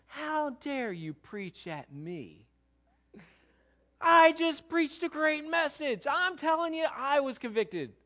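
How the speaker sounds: hammed-up huffy protest at his wife; sarcastic indignation. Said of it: "there's a little bit of sarcasm in that".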